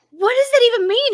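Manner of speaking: high, loud incredulous question; baffled disbelief. Retold or imitated. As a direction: ask it utterly bewildered. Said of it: "And I was like".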